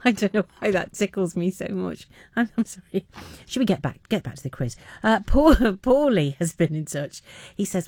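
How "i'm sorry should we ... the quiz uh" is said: usual speaking voice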